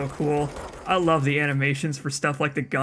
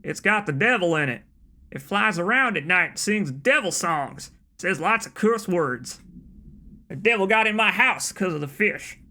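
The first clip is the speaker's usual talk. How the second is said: hammed-up Southern accent, higher pitch; 5 semitones higher than usual. Man begging the governor to handle a devil fish, Southern accent. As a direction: go broad Southern, worked up about the devil. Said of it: "should I read this in an offensive Southern accent".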